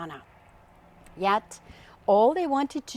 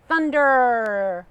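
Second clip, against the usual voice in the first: louder, boom the word out; booming exclamation; 2 semitones higher, 7 dB louder than usual. Acted. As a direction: make it crash like thunder. Uncle booms the word thunder.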